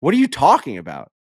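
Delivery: lightly acted astonishment; retold disbelief. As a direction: react with baffled disbelief. Retells his disbelief at Bernie's reveal.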